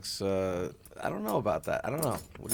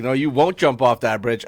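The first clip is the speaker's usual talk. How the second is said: mock dare; 4 semitones higher, 11 dB louder than usual. Acted dare between voices.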